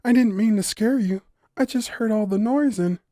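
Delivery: high, timid apology; a shy forest girl explaining herself; apologetic fawn girl. A narrator's reading. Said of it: "the girl pleaded".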